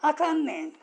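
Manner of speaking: demonstrated Osaka-dialect reply. Shown as a demonstration. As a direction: lift it with an Osaka lilt. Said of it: "大阪弁のお話".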